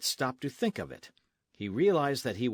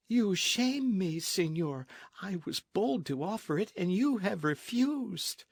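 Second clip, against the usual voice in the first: much higher, slow wounded voice; hurt young lady; 10 semitones higher than usual. Acted. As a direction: let the rejection sting. Lolita hurt.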